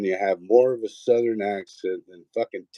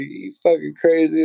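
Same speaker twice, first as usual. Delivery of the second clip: high, louder incredulous question; mock incredulous echo; 7 semitones higher, 4 dB louder than usual. Echoing Jen's question back to her, high and incredulous. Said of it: "And I was just like".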